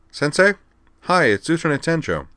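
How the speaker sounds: light up at hearing him; eager greeting. Acted.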